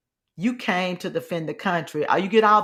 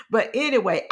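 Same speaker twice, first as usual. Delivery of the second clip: normal talking voice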